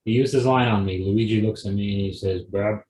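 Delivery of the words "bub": usual speaking voice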